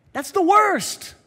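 high, loud and slow punchline; loud, drawn-out preacher's payoff; emphatic shout. Real, not acted. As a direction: drive the point home with a big shout.

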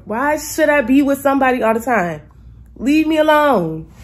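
loud, high and slowed, fed up; loud, high exasperated venting; exasperated rant. Real, not acted. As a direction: let it all out: leave me alone. Venting annoyance at strangers.

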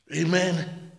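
ordinary delivery

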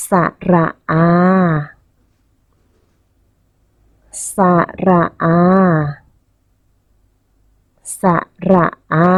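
สระอา syllable by syllable, long held อา; model pronunciation. Shown as a demonstration. Teacher asks children to repeat after her, model pronunciation of long สระอา. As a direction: space out สระอา and draw out the final vowel. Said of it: "เด็กๆพูดตามคุณครูด้วยนะคะ".

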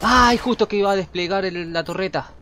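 loud, high surprised cry; startled yelp. Real, not acted. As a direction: yelp as you get killed. Killed just before deploying turret.

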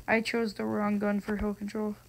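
ordinary delivery

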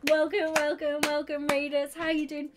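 high, loud, buzzing greeting; excited welcome. Real, not acted. Streamer welcoming incoming raiders, excited and loud. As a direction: hail the crowd, still thrilled.

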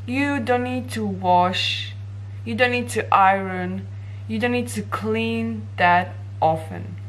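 usual speaking voice

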